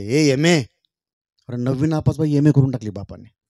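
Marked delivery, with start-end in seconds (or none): 0.0-0.6 loud, brusque call to his wife; retold holler across the house; father's shout. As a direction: imitate dad calling out as he gets home. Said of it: "ते जोरात ओरडायचे".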